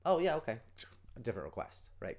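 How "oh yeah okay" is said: cheerful agreement. Retold or imitated. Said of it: "Would you close the door".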